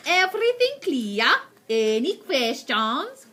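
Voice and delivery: loud, high commanding witch; Grand High Witch voice. Acted. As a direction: boom orders like a cruel witch queen.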